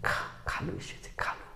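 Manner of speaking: hushed secret whisper; slow secretive whisper. Shown as a demonstration. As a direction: whisper it into someone's ear. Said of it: "চুপি চুপি যদি বলতে হয়".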